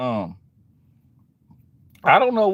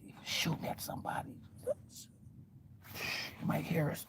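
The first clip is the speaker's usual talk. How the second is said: whispered, scared aside; fearful whisper; 20 dB quieter than usual. Retold or imitated. Eavesdropper warns friend he might hear, whispering.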